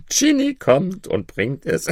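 high, tipsy boasting; drunk. A narrator's reading. Tipsy Maruf tells of his genie, drunk. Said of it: "in seiner Betrunkenheit".

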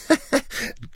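high, tipsy giggle; drunken laugh. Acted. Tipsy Maruf amused by his own secret, drunken laugh.

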